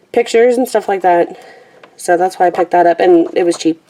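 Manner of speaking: normal talking voice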